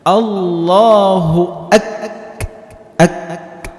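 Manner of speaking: Allahu drawn out slowly, the k of ak- stopped then released with air; slow chanted Allahu with a breathy held k; held takbir. Shown as a demonstration. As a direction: stretch Allahu, catch the k and let breath out. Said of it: "tertahan, keluar angin".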